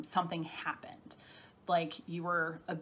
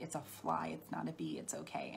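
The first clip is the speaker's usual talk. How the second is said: put-on soothing a child; 3 semitones lower, 10 dB quieter than usual. Family calming a screaming toddler.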